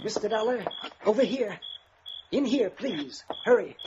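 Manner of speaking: man secretly calling the detective over, hushed and urgent. Acted. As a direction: beckon him over without being overheard. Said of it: "Why all the secrecy".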